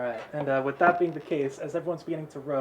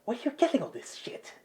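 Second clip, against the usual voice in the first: higher, incredulous Bob; a lightly voiced, higher, bewildered Bob; Bob's voice; 8 semitones higher, 4 dB quieter than usual. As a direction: ask it like a baffled sidekick. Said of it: "And Bob's like".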